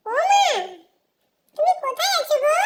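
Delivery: loud, high call for mother; child calling mum loudly from another room. Acted. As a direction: shout across the house for mum.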